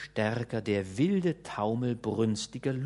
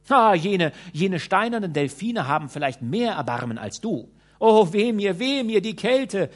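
high, loud despairing lament; desperate pleading; 5 semitones higher, 5 dB louder than usual. A narrator's reading. Lady begging Peregrinus to shelter her, loud wailing. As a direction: wail and beg to be taken in.

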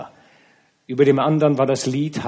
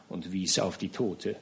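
ordinary delivery

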